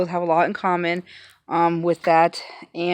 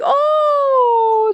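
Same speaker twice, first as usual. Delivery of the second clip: high, loud, drawn-out "oh"; excited retold reaction; 5 dB louder than usual. Vlogger recalling seeing a friend's box, high excited "oh". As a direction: squeal it as an awed reaction. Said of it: "and I was like".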